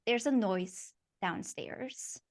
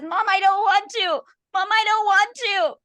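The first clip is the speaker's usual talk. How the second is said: much higher, loud desperate cry; terrified scream; 12 semitones higher, 9 dB louder than usual. Acted. Child Ashley screaming at her mother, terrified. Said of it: "She says".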